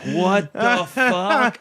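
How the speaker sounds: high, very drawn-out indignation; Judy Tenuta impression. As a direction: stretch out an imitated comic's outraged disbelief. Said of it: "And Judy's like".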